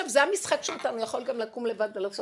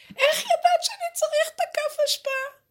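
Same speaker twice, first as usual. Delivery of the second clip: amazed; 8 dB louder than usual. Retold or imitated. Amazed father asks about daughter.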